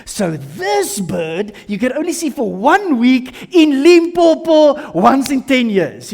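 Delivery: slow, drawn-out, building to loud and high; enthusiastic birder. Acted. Birder shows a rare bird in book, enthusiastic. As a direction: stretch out every fact with relish.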